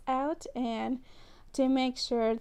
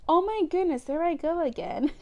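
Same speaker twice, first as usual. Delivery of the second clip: higher, louder exclamation of dismay; surprised at herself; 6 semitones higher, 3 dB louder than usual. Real, not acted. A vlogger catching her own mistake, surprised exclamation. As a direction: catch yourself in the same slip, amused. Catches repeating the wrong braid.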